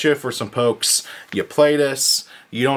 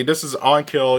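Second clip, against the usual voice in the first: ordinary talk, nothing marked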